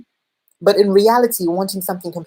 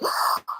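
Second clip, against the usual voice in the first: re-enacted sharp intake of breath; short, breathy gasp of surprise; startled gasp; 8 dB quieter than usual. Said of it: "I actually was startled".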